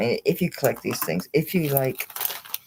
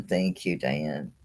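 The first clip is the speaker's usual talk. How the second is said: warm; 9 semitones lower, 4 dB quieter than usual. Real, not acted. Soft thanks to friend.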